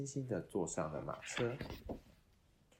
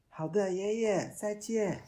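higher, louder chirpy puppet; cheerful puppet voice; 13 semitones higher, 9 dB louder, slower than usual. Acted. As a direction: cheerfully say goodbye like a little puppet. Puppet answers grandpa.

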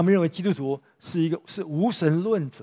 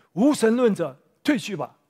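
bold declaration; 6 semitones higher, 4 dB louder than usual. Retold or imitated. Polycarp points at the emperor.

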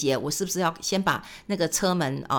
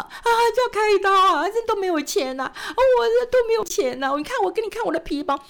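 high, loud wailing plea, then faster; hammed-up imitation of a weeping driver; mock crying; 13 semitones higher, 4 dB louder than usual. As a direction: wail like a sobbing man who needs cash. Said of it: "driver crying about money".

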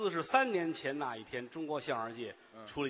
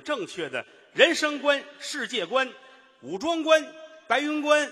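each word higher and louder; building praise; 10 semitones higher, 8 dB louder than usual. Acted. Comedian heaping praise on his partner, building higher and louder. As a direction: climb up the list.

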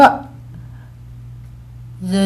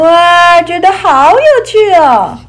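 高起来的兴奋语气; 开心地提高声音; excited; 6 semitones higher, 6 dB louder, slower than usual. Acted. Story: feeling fun. (兴奋地说)

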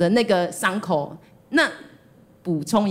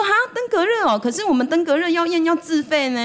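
mock doctor; 9 semitones higher than usual. Mimicking the doctor's reply.

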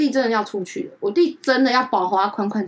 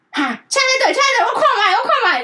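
high, loud mock-excited auntie; gushing aunt; 13 semitones higher, 5 dB louder than usual. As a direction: coo over it like a thrilled aunt. (学阿姨高声夸张地嚷嚷)

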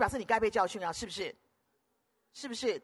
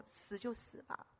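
breathy, slow whisper of resolve; quoting Esther in a slow whisper; hushed whisper; 18 dB quieter, slower than usual. Retold or imitated. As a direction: hush it, slow, as if resigned to die. Esther quoted in a sermon.

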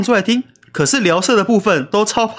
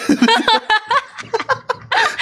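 high giggle. Real, not acted. Laughing at the nasal voice.

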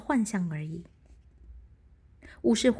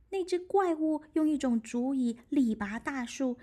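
ordinary delivery

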